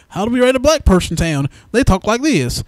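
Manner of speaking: a hint of a clueless old screenwriter.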